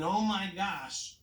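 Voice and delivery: slow, drawn-out mock horror; acted slow realization; the king's dismay. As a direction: stretch it out as the stunned king. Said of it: "think of the king thinking".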